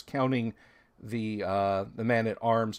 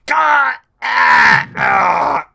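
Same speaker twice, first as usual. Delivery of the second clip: loud, strangled death cries; dying scream; 10 semitones higher, 14 dB louder, much slower than usual. Acted. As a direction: yelp as a spear runs you through. GM voices hobgoblin being speared.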